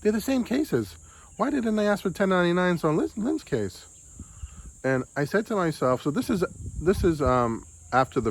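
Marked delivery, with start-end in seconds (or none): 0.0-3.9 higher, incredulous reread of own thought; a baffled, puzzled question to himself; baffled self-quote. Retold or imitated. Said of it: "I'm like".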